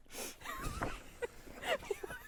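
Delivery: squealing laugh at own joke; high giggle burst. Real, not acted. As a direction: let a high laugh break out.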